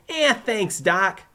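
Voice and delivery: put-on high, loud Brooklyn accent; Joey's normal accent. A narrator's reading. Pupil thanking his teacher, Brooklyn accent. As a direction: thank him in broad Brooklynese. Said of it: "Joey said in his normal accent".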